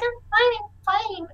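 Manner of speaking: put-on high child voice brushing off fuss; child character. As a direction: wave off the worry in a small, high voice. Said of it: "and she's like".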